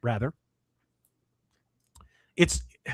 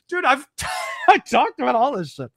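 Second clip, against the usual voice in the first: excited shout; 6 semitones higher than usual. Real, not acted. Reacts to article agreeing with him.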